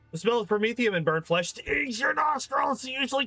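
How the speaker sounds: narration pushed high through real pain; in agony. Real, not acted. Reader clawed by the cat mid-narration, in agony. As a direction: read on while being hurt, pitch climbing. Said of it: "without the agony this time".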